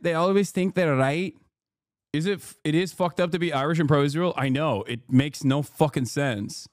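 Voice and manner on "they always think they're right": ordinary delivery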